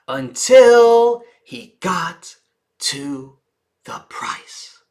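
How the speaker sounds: crawling slow, price whispered; dramatic slow-down to a whisper; very slow pace. Shown as a demonstration. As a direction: stretch every word, whisper the price. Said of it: "Notice my pace".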